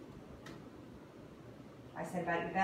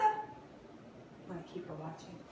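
quiet aside; 3 semitones lower, 7 dB quieter, much slower than usual. Real, not acted. Aside to viewers.

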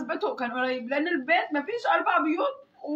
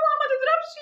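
much higher-pitched plea to stop; retold protest; 10 semitones higher, faster than usual. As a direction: re-enact fending off a blow, pitched high. تصد الضرب في مشهد محكي. (احتجاج محكي بصوت عالي الطبقة)

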